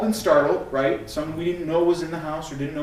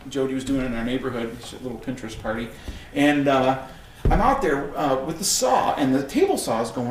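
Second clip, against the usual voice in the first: ordinary talk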